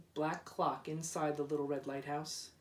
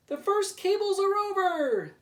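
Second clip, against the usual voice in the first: much higher, loud happy call; 14 semitones higher, 9 dB louder than usual. Acted. Workmen calling to others across the river, loud happy call. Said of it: "they called".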